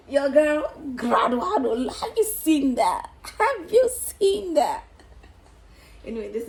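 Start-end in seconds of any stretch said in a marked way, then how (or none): 0.1-4.8 loud, high gleeful bragging; proud graduate showing off to viewers; excited boasting. Real, not acted. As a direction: crow it with delight. Celebrating graduation.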